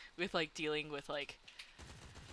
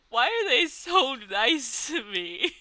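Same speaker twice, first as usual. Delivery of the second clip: laughing; 7 semitones higher, 11 dB louder, slower than usual. Real, not acted. Laughing at teachers' kindness.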